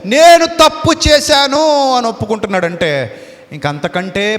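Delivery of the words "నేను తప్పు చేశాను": higher, louder, slow cry of guilt; king admitting his wrong in tears, loud and slow; tearful admission. Acted. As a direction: wail the confession out. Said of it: "కన్నీటితో ఏడుస్తూ".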